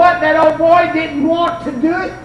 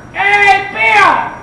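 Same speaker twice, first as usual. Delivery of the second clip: loud, high call; retold holler across a distance; yelling out; 8 semitones higher, 5 dB louder than usual. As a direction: shout to get someone's attention. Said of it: "I yelled out".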